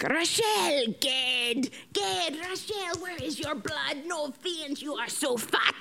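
light mock Malagasy accent; imitating local nurses. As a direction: mimic the locals' blunt teasing.

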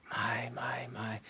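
soft, near-whispered marvelling; hushed wonder. Real, not acted. Preacher marvelling at the gospel message, hushed. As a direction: breathe it out in quiet awe.

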